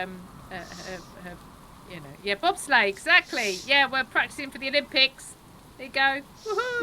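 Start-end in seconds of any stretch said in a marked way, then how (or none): 6.4-6.8 bright happy whoop; excited cheer. Real, not acted. Cheering the bobsleigh.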